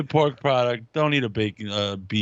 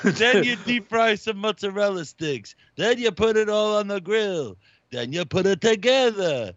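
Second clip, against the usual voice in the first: Aki impression; 9 semitones higher, 3 dB louder than usual. Guest imitates the deli guy Aki taking a breakfast order. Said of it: "He's like".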